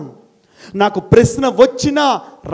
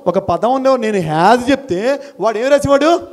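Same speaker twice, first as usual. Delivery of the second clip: ordinary talk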